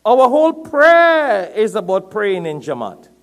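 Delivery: loud, high sermon delivery, words stretched; impassioned preaching. Real, not acted. Preacher urging the congregation not to be aloof, loud and impassioned. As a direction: preach it at full force.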